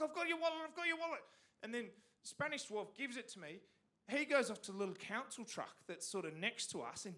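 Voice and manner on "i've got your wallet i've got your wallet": higher, eager calling out; excited call. Retold or imitated. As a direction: announce the wallet is back, thrilled. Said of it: "And I'm like".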